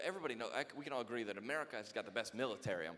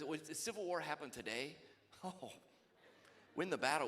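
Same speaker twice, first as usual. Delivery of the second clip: usual speaking voice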